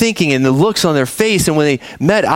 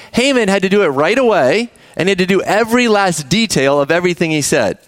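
usual speaking voice

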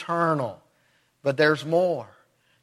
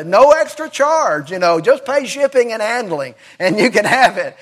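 higher, excited mock infomercial patter; TV commercial pitch; 4 semitones higher, 3 dB louder than usual. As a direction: rattle off the offer like a TV pitchman, amused. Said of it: "they tell you what else you can have".

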